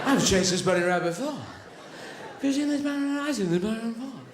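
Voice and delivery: dawning amazed recognition, pitch swooping high; greyhound's déjà vu. Acted. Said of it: "Now they should be going".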